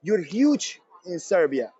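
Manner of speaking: put-on slow, stretched Eastern European accent on "You're huge in Serbia". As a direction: mimic the Serbian fan's accent, drawing out "huge". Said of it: "this Eastern European accent".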